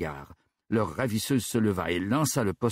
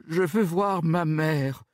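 high, slow crying plea; a little girl pleading through tears; tearful murmur; 8 semitones higher, slower than usual. Acted. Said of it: "murmura-t-elle". (supplique en pleurs, aiguë et lente)

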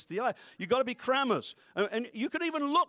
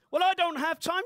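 whiny excuse, pitched up and louder; mock excuse; 7 semitones higher, 6 dB louder than usual. Preacher mocking the congregation's lame excuse, whiny. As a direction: mimic a lazy churchgoer's excuse.

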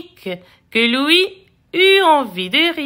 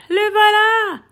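higher-pitched, eager announcement; excited cry; 6 semitones higher than usual. A narrator's reading. Dog spotting his boy arriving, excited cry. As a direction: announce the long-awaited arrival.

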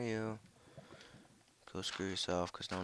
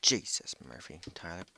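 ordinary delivery

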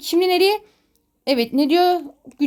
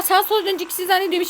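ordinary talk, nothing marked

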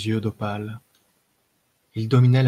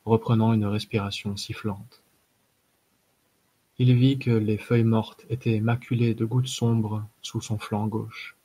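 ordinary delivery, nothing marked

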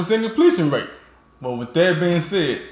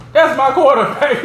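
proud cheer; 7 semitones higher than usual. Retold or imitated. To friends, admiring Ben's trick play, proud cheer. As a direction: hype it up like a proud teammate. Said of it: "I'm looking like".

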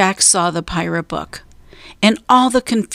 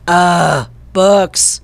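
growling; 4 dB louder than usual. A narrator's reading. Captain scorns Pinky's find, disgusted growl. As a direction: growl "books" with disdain. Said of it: "growled Cap'n Bones".

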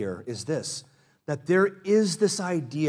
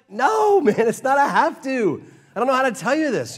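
high, loud, laughing "No, man"; laughing correction; 8 semitones higher, 3 dB louder than usual. Retold or imitated. Pastor laughing off a new believer's "have to", high and loud. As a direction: burst out laughing at the idea.

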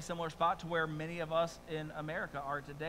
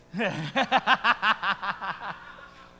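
real burst of higher, louder laughter; laughing; 4 semitones higher, 7 dB louder than usual. Real, not acted. Preacher amused by the congregation's reaction, laughing. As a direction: crack up in a long laugh.

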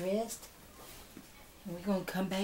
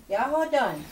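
loud call; 8 semitones higher, 7 dB louder than usual. Real, not acted. Calling out loudly.

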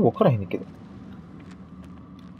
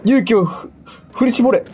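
psyching himself up; 10 semitones higher than usual. Real, not acted. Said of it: "俺頑張れ".

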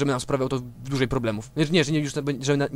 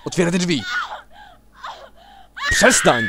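louder, half-laughing yell; laughing shout; 6 dB louder than usual. Acted. Player shouting at the door, laughing yell.

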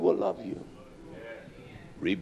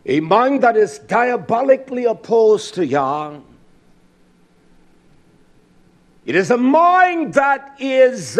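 loud, high fiery preaching; fiery sermon delivery; 5 semitones higher, 6 dB louder, slower than usual. Acted. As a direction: preach it hard to the congregation. Preaching at full force.

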